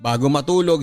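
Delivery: normal talking voice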